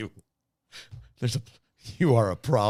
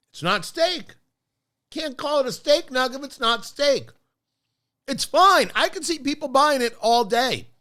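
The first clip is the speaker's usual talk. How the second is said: indignant; 6 semitones higher, 4 dB louder than usual. Real, not acted. Indignant rant about steak nuggets.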